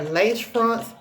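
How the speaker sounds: ordinary talk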